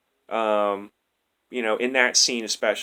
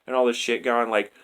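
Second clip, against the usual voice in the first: usual speaking voice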